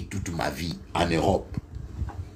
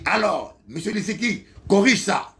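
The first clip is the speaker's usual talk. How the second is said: much higher, dismissive order of a chief engineer; condescending boss; 12 semitones higher, 4 dB louder than usual. Retold or imitated. Chief engineer brushes off the trainee, condescending order. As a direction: toss the task at him from above.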